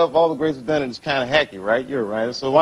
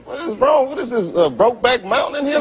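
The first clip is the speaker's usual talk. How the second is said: mock outrage; 7 semitones higher than usual. Actor's mock outraged reply.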